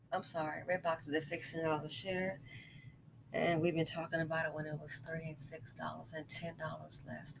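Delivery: quiet aside. Real, not acted. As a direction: drift off mic, muttering to yourself. Distracted aside over stock news.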